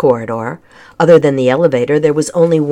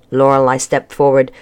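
plain reading voice

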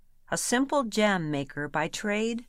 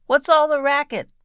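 high, loud call; cried out; 9 semitones higher, 9 dB louder than usual. A narrator's reading. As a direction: shout it round the door. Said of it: "Cindy cried".

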